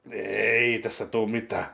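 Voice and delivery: ordinary talk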